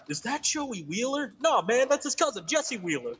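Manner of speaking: high-pitched imitation of the dub dialogue; quoting the dub. Retelling an anime scene to friends in a high mock voice. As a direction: squeak out the anime line as a joke. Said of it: "Direct quote".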